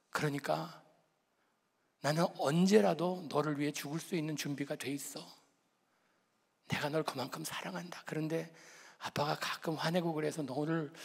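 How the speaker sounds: put-on soft, hushed apology to a scared child; tender father. Father comforting his trembling son, soft and tender. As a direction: speak gently, as if holding the boy close.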